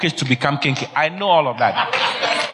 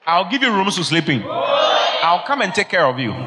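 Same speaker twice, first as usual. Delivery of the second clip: higher-pitched, assured big promises; grand, confident reassurance to poorer neighbours; confident boasting; 5 semitones higher than usual. Acted. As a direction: declare it like a future benefactor. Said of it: "that's how you talk".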